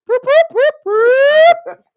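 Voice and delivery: high, loud boop boop of a siren; loud, high siren noise; siren imitation. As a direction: mimic a police car whooping.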